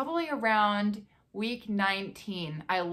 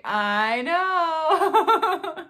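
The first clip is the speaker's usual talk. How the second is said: higher, louder "I know" through a laugh; laughing agreement; 9 semitones higher, 8 dB louder than usual. Real, not acted. Answering husband's shock, laughing high agreement.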